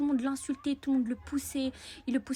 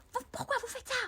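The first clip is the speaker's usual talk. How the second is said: much higher, pleading; mock cry; 12 semitones higher, 4 dB quieter than usual. Girl scolding classmates, mock upset. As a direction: plead high and shrill-free. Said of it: "J'ai crié".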